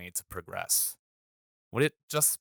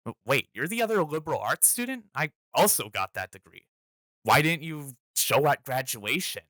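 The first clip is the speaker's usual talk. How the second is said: stern student; 6 semitones higher, 4 dB louder than usual. Retold or imitated. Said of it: "the student sternly asked me".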